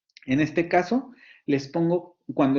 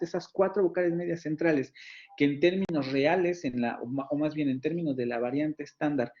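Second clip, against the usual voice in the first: ordinary talk, nothing marked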